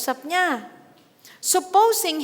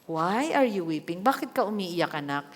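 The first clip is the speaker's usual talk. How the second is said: tender asking; 6 semitones lower, 4 dB quieter than usual. Pretend. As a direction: coax the child gently. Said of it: "tanong muna tayo". (malambing at mahinang tanong ng magulang sa anak)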